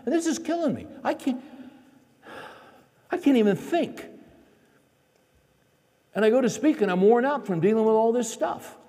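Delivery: high-pitched, worked-up venting; exasperated outburst. Retold or imitated. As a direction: pour out your frustration to your wife, piling up complaints. Acts out frustrated outburst to wife.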